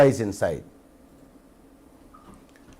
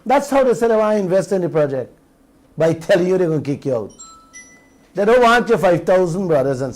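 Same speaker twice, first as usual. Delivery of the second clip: rattled off fast and higher in indignation; quick, raised, disbelieving repeat; fast higher retort; 3 semitones higher, faster than usual. Real, not acted. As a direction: fire "That's how they say" off quickly, pitched up.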